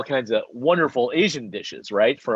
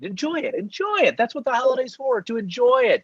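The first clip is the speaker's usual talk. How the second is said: high, lilting enjoy it; sing-song encouragement; 12 semitones higher than usual. Acted. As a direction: coax him playfully to enjoy. Urging cohost to savor wine.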